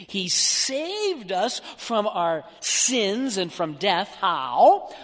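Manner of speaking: usual speaking voice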